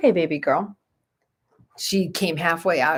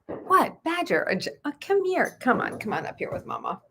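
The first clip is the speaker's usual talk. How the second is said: high, coaxing dog voice; squeaky mama-dog voice coaxing; voicing the dog; 11 semitones higher than usual. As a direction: play the mother dog calling her pup, high. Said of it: "in the doorway of the bathroom like".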